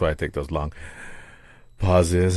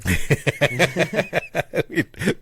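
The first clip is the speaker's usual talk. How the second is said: laughing; 10 semitones higher than usual. Real, not acted. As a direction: burst of high-pitched laughter. Laughing at setup joke.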